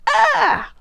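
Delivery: high nervous yelp; sudden high yelp. Shown as a demonstration. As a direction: squeal a short high "Ah!". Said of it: "and go".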